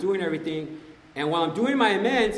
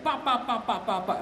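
rapid higher-pitched mouthing off; mock smack talk; 5 semitones higher than usual. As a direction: imitate a friend yapping nonsense syllables. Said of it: "this fool just starts talking smack".

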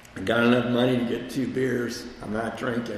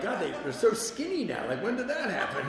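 amused disbelief; 7 semitones higher than usual. Retold or imitated. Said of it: "and I'm like".

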